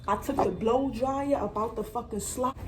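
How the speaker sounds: ordinary talk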